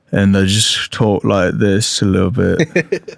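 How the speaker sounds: low, slow British accent. Shown as a demonstration. Showing co-hosts the accent they fall into, British accent. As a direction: drawl it in a loose British rogue voice. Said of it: "Jack Sparrow slash [name]".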